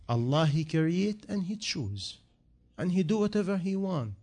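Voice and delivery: ordinary talk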